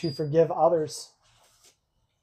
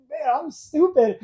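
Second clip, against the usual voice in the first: amused self-mockery; 8 semitones higher than usual. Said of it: "I was just like".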